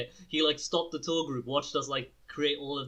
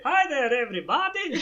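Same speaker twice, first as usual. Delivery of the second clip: much higher, louder cheery cartoon greeting; Doctor Nick; 12 semitones higher, 7 dB louder than usual. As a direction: sing it out like the Simpsons quack doctor. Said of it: "you know, like, Doctor Nick from Simpsons?".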